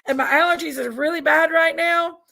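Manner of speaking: ordinary talk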